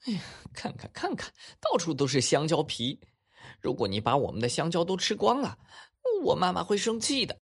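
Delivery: Harry scolding the banana-eating monkey; child voice. Acted. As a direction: fret like a kid at the mess. Harry scolds then coaxes.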